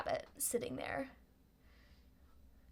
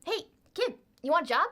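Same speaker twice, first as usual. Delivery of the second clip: high cheeky rabbit voice; a sly rabbit pitching a job; pixie; 6 semitones higher, 6 dB louder, faster than usual. Acted. Rabbit talking to Spitz.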